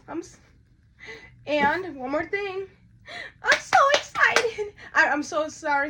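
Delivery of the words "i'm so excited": high, loud, drawn-out excitement; excited squeal. Real, not acted. Unboxer to fans before revealing the doll, excited squeal. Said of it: "I'm sorry if I didn't sound excited earlier".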